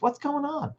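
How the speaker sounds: lifted, gentle curiosity on What's going on; curious question. Shown as a demonstration. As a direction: ask it as if you truly want to know. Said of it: "I'm just getting a little curious".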